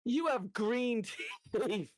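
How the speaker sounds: put-on high, slow child voice with a laugh; a toddler's blunt remark, laughing through it; imitating his son. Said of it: "And he goes".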